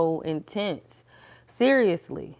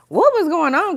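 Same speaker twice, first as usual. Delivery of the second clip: high, louder incredulous question; mock bewilderment; 10 semitones higher, 6 dB louder than usual. Looking back on this morning's mood, high bewildered question. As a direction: exclaim it, pitched way up. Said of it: "look back and be like".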